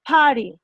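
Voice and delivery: Party with a strong, explosive P. Shown as a demonstration. Teacher showing the English P to students. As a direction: pop the P in Party. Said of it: "O la P que decimos".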